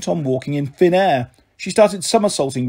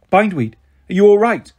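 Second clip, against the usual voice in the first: louder, higher anxious question; worried for the fallen fairy; 4 semitones higher, 5 dB louder than usual. A narrator's reading. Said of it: "I asked anxiously".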